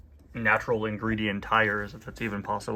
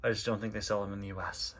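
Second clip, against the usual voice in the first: ordinary delivery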